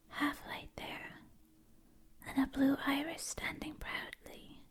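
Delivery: normal talking voice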